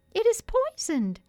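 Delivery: high, loud fairy cry; urgent fairy warning. A narrator's reading. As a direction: warn him urgently in a tiny voice. Fairy urgently warns Peter of poison.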